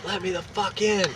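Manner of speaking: high-pitched mock yell, held back in volume; retold yelling. Angry man banging on Vince's door, mock yelling. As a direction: imitate a yell without real loudness.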